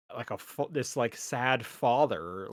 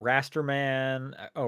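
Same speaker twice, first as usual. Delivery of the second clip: ordinary talk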